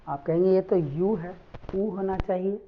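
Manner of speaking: ordinary talk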